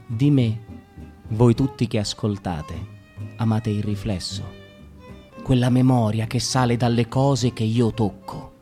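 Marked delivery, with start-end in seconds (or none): none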